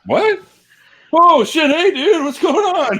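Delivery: high, loud excited yelp; starstruck surprise. Retold or imitated. Greeting [name] behind him, high and loud. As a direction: burst out thrilled at a rock star.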